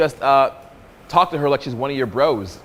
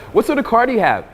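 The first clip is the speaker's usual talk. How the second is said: put-on girl asking; 9 semitones higher than usual. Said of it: "I'll just say that".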